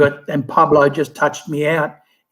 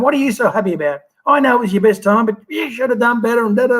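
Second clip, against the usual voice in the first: imitating the coach's exasperated nagging, pitched higher; a hammed-up, higher coach voice grumbling; mock scolding coach; 7 semitones higher, faster than usual. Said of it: "and he's going".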